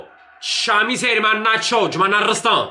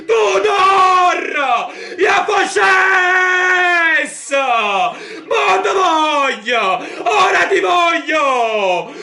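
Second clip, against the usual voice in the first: goal celebration shout; 6 semitones higher, slower than usual. Real, not acted. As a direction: scream with joy at the goal.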